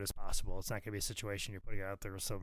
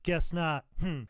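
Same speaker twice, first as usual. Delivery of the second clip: a light, higher mock Jim Ross; imitating Jim Ross; 6 semitones higher, 4 dB louder than usual. Jim Ross teasing him about figures, lightly imitated. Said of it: "he'd come by again and goes".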